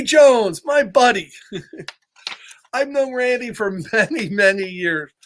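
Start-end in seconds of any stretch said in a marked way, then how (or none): none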